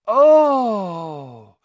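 loud, high drawn-out squeal. A narrator's reading. Lavender Brown pointing across the paddock, squealing. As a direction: squeal sliding down in pitch. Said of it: "squealed Lavender Brown".

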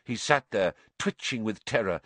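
ordinary delivery, nothing marked

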